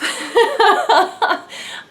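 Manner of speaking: high-pitched embarrassed giggle. Real, not acted. Laughs at herself.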